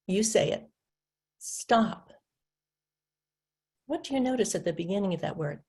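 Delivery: ordinary talk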